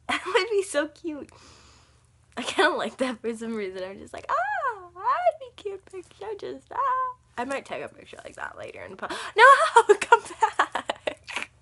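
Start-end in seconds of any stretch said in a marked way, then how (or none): 4.3-7.2 very high, cooing "ah" of delight; mock-gushing, pitched way up; gushing squeal. Imitating own gushing reaction. 9.4-11.5 high, loud cry of dismay; loud, high-pitched plea; calling out. Real, not acted. Calls out as something gets away.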